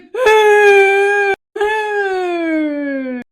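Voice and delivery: long, high crying howl; hammed-up drawn-out wail; mock wail. Husband watching wife being restrained, long mock wail. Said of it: "He's like".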